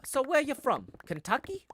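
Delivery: high, loud, surprised salon lady. Retold or imitated. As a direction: play the hairdresser guessing the accent. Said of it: "The lady's like".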